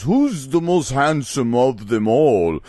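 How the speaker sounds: slow, vain villain voice; Kubla Kraus. Retold or imitated. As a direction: preen slowly over each word. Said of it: "he goes".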